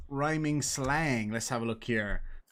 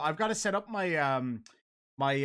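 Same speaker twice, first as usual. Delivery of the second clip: ordinary talk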